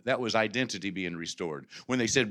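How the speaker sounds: ordinary talk, nothing marked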